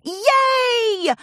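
high, loud cheer; excited yay. Acted. Three fillies cheering about the party, high excited yay. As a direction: cheer together.

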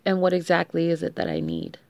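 normal talking voice

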